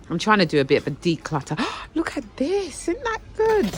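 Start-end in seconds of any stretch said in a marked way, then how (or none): 1.9-3.7 high, delighted squeal of a find; thrilled by a bargain; high excited delight; excited gasp. Real, not acted. Excited find while shopping.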